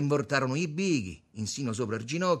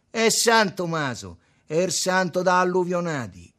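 loud mockery; 7 semitones higher, 10 dB louder than usual. A narrator's reading. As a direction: proclaim it mockingly. Il Buddha sfotte Tommaso. (presa in giro a gran voce)

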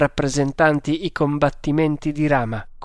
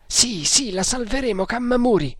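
high-pitched fervent exclamation; elated, pitch far up; exalted; 9 semitones higher than usual. A narrator's reading. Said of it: "esclamò Tremal Naik con esaltazione".

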